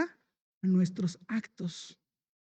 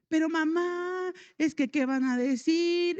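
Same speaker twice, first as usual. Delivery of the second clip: pleading daughter; 6 semitones higher, slower than usual. Retold or imitated. Daughter voiced pleading in story.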